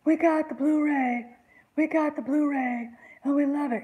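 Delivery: high, loud, gushing fan voice; mock fan gushing. Mocking retweeted fans, high and loud gushing. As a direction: gush like a fan mocking the tweets.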